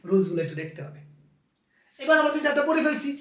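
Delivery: normal talking voice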